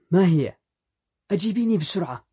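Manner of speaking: high, loud-starting, urgent question; impatient demand. A narrator's reading. Husband demands to hear his wife's idea, impatient. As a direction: press for the answer at once.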